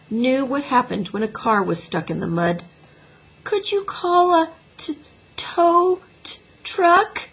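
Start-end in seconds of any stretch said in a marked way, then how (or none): 3.4-7.3 put-on high, halting little girl on the verge of sobs; tearful sniffling. A narrator's reading. Stuck little girl asks teacher for help, tearful sniffling. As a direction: stammer it high through sniffles. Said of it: "asked with a big sniff".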